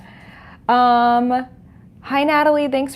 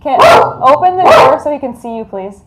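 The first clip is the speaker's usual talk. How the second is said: repeated loud call; 2 semitones higher, 8 dB louder than usual. Real, not acted. Calling Kit a second time to open the door, insistent and loud. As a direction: call again, firmer and louder.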